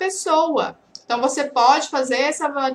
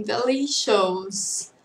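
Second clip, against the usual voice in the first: slow, savoring; 5 semitones lower, 5 dB quieter, much slower than usual. Shown as a demonstration.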